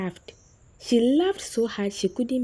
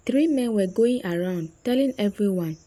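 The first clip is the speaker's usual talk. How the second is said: ordinary delivery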